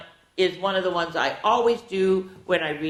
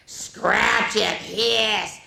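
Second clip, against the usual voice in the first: slow spooky cat read; 5 semitones higher, much slower than usual. Acted. Cat riddle read slowly.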